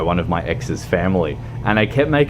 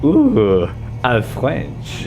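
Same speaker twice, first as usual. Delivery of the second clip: mock French accent; 5 semitones higher, 3 dB louder, much slower than usual. Mock reaction to French name.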